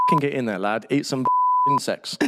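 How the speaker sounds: put-on broad Northern English accent, jokey; mock Northern lad egging him on. Quoting his mate in a Northern accent, laughing. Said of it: "That was a really good Northern accent".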